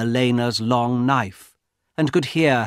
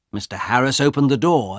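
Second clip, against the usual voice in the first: usual speaking voice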